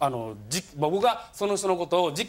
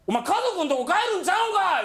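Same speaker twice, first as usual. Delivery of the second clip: mock telling-off; 12 semitones higher than usual. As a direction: confront him in disbelief. Teller confronts old man.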